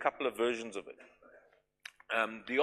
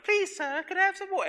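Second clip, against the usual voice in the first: much higher, pinched, put-on orphan's English accent; bad English accent; 4 dB louder than usual. Preacher imitating Oliver Twist asking for more food, high, in a bad English accent. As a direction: squeak it out like a timid Victorian orphan. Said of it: "that scene of".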